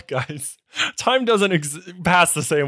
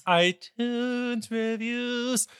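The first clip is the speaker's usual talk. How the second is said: drawn-out announcement; 10 semitones higher, much slower than usual. Acted. Echoing with drawn-out excitement.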